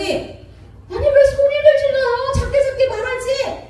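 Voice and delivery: high-pitched mock indignant retort; hammed-up marital protest; acted yelling back. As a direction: play a spouse snapping back, voice jumping up. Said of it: "나도 갑자기".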